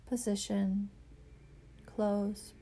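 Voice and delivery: ordinary delivery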